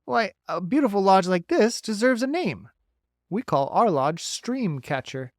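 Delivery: bright, folksy beaver. Acted.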